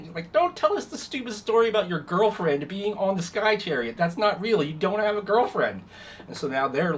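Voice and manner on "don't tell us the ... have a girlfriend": high, loud scoffing; bickering scorn. Acted. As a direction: cut him down mockingly. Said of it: "all bickering at each other".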